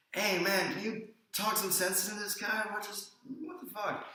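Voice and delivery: high-pitched, mock-indignant plea; exasperated appeal. British guy appeals to big bystander.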